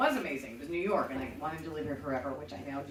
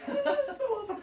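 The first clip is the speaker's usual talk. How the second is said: much higher, thrilled and laughing; naive delight. Retold or imitated. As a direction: gush like a wide-eyed tourist. Naive tourist thrilled by growl.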